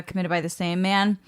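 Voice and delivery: normal talking voice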